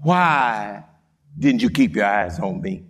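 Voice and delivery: low-pitched acted question from Jesus; low reproach. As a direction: ask it low, as a measured rebuke. Acts Jesus questioning Peter.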